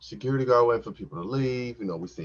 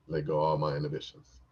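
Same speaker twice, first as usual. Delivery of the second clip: normal talking voice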